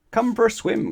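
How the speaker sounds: high, loud little call; eager cry. Acted. Mister Small calling Mister Tall to swim, high and loud. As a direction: call out gleefully, tiny and high. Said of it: "cried Mister Small".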